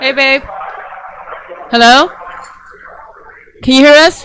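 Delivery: calling out. Real, not acted. Wife trying to reach husband by phone, calling out loudly. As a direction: call loudly to someone who can't hear.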